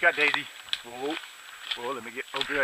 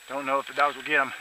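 usual speaking voice

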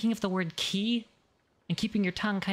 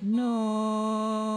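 larynx raised on a long note; 2 semitones higher than usual. Shown as a demonstration. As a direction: lift the throat, hold the tone. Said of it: "raise that laryngeal".

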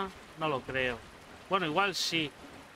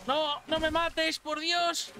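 high, loud pleading scream; terrified horror victim; 12 semitones higher, 3 dB louder, much slower than usual. Acted. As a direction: beg for your life, shrieking. Le rodean y le van a matar. (víctima aterrorizada de película de terror)

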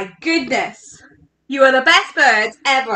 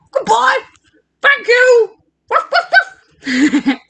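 dog's goodbye; 10 semitones higher, 3 dB louder, slower than usual. Acted. Dog says goodbye to children.

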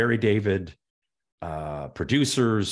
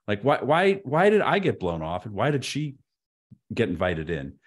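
ordinary delivery

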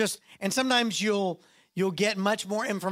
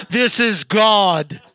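booming God voice; 13 dB louder, much slower than usual. Pretend. God booms an audible voice at a busy man.

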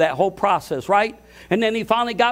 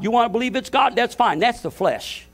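normal talking voice

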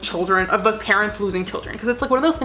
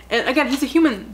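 ordinary talk

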